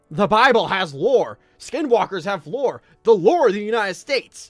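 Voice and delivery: loud, insistent examples; emphatic point-making. Real, not acted. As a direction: drive each example home at high volume. Argues real things have lore.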